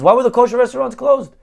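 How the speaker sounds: high, fast comic interrogation; incredulous rabbi. Acted. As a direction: press for the reason in disbelief. Rabbi keeps demanding answers.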